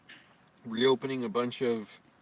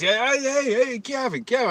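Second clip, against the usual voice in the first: interrupting shout; 14 semitones higher, 10 dB louder than usual. Retold or imitated.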